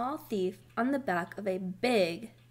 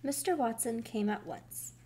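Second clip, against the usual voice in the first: ordinary delivery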